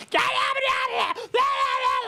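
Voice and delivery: very high mock screech; imitated angry bitching. Girlfriend yapping at security guards, hammed-up high-pitched screeching. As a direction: squawk like a furious girlfriend in caricature. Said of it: "bitching at the security guards".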